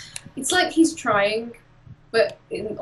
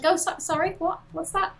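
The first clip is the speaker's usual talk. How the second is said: mock innocent; 8 semitones higher than usual. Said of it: "basically a douchebag".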